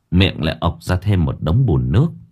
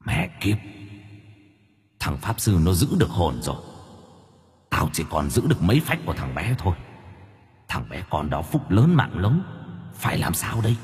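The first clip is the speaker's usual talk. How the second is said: much softer, eerie ghost voice; hissing demon; 9 dB quieter than usual. A narrator's reading. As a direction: whisper like a spirit under the lake. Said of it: "tiếng con quỷ ... rít lên thù hận".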